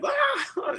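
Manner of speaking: very high mock scream; retold scream. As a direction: shriek it out in panic. Freaking out and screaming and yelling.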